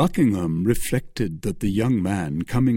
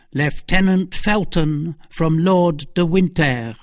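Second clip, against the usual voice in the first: slow, raised formal announcement; servant announcing; 7 semitones higher, 4 dB louder than usual. Acted. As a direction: announce the visitor like a valet at the door. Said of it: "said Patrick".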